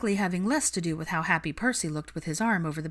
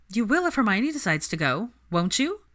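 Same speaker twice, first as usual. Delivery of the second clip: put-on higher, louder, playful young woman; lively Ginny; 4 semitones higher, 4 dB louder than usual. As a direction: tease him into it brightly. Narrator voices Ginny.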